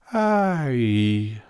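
langes, träges Seufzen; Seufzer. A narrator's reading. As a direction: seufz es schläfrig heraus. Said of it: "sagte das Faultier und seufzte". (schläfriges, langgezogenes Ai)

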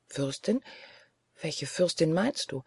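understated bewilderment; uncomprehending. A narrator's reading. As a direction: ask quietly, not understanding at all. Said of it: "starrte mich verständnislos an".

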